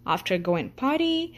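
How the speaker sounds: ordinary delivery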